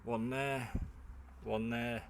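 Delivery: normal talking voice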